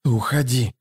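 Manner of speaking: hissed through short breath; breathless, hissed command; gasping hiss. A narrator's reading. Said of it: "задыхаясь, прошипела Лорн".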